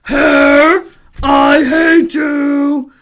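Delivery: loud, slow, drawn-out holler; man's angry yell. Acted. Man yelling at hair on behind, loud angry holler. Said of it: "the man yelled".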